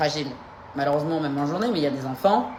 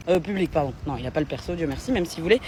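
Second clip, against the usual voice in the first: normal talking voice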